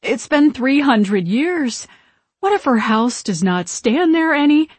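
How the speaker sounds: fretful, high protest; anxious objection. A narrator's reading. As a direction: plead doubtfully with the boss sister.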